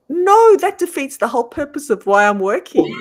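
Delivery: high, loud acted objection; indignant protest. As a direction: argue like an outraged kid with mum. Retold argument with mum over pay.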